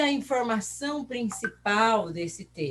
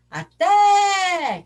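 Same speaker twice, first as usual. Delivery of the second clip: "Até" shouted high with a long held "té"; calling from afar; 10 semitones higher, 9 dB louder than usual. Shown as a demonstration. Teacher shows pupils the strong syllable, calling out "Até". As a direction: call "Até" as if hailing someone distant. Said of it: "chama longe a palavra".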